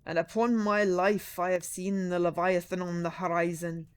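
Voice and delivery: put-on slow, loud old salt spinning a yarn; old sea captain boasting in a slow, loud voice; deep raspy sea captain. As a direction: growl it out slow like a weathered captain. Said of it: "with a deep, raspy voice".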